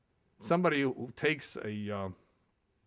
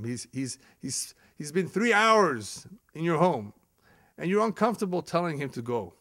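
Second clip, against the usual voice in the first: usual speaking voice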